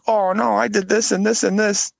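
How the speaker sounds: high-pitched imitated excuses; mock sob story. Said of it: "I didn't wanna get the sob story".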